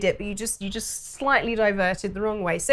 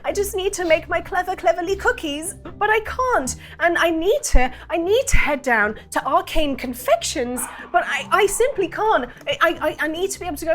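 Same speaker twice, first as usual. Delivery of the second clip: flustered baker; 2 semitones higher than usual. Acted. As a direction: pour out the problem in a rush. Distressed baker explains problem.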